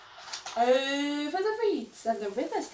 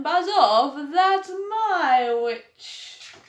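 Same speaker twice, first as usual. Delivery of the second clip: loud, very slow growling threat; monstrous beast; 4 semitones higher, 6 dB louder, much slower than usual. Acted. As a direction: snarl it out to scare the dragon. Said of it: "yowl and a growl".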